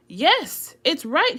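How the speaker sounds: high, loud angry outburst; furious reply. Acted. Jonah answers God back in a loud rage.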